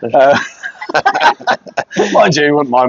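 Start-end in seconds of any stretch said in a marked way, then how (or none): 0.4-2.1 high laughing burst; high burst of laughter. Real, not acted. Laughs at the joke.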